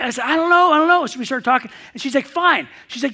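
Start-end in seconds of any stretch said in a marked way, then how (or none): 0.2-1.0 loud, high, flustered protest. Retold or imitated. Clueless man answering the coworker who tried to kiss him, flustered. 2.3-2.7 loud, high mock exasperation; huffy. Rebuffed coworker ending the visit, imitated huff. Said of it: "starts to huff and go out".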